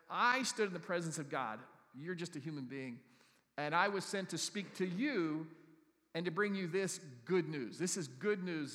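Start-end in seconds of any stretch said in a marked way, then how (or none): 0.1-1.6 bold angel. Acted. Angel repeats claim. 3.6-8.7 proclaiming angel. Acted. Angel declares good news, loud.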